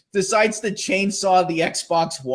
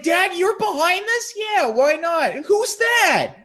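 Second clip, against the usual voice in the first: high, raised accusing questions; mock shocked teen; betrayed surprise; 13 semitones higher than usual. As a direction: accuse your own family. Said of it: "it's like".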